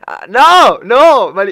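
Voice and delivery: high, swooping shouted refusals; loud protest. Real, not acted. Protesting to viewers amid laughter, loud and high.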